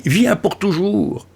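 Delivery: crié fort et lentement, voix plus haute; lance-le comme un appel passionné; cri joyeux. A narrator's reading. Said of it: "Il crie". (crié de joie)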